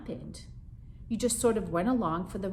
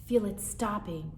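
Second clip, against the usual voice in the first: ordinary delivery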